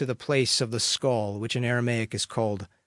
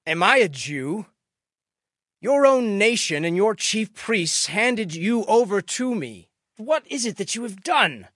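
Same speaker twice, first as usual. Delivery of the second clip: louder, higher, challenging questions; Pilate questioning; 7 semitones higher, 7 dB louder than usual. A narrator's reading. Pilate interrogating Jesus, raised challenging voice. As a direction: put the prisoner on the spot, voice raised.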